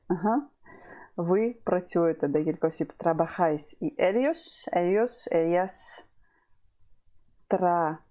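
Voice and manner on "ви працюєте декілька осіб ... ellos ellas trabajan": ordinary talk, nothing marked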